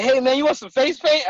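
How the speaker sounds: hunting guy's offer. Retold or imitated. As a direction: lightly voice the eager guy offering paint. Hunting guy offers face paint.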